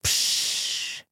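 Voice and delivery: quiet, nasty hiss like a leaking balloon. A narrator's reading. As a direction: hiss like air escaping a balloon. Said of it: "тихое, но очень противное шипение".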